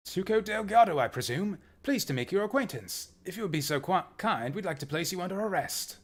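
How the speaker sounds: high-pitched, polite mock British; British accent. British professor placing Tuco under arrest, polite British accent. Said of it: "that's my best British accent".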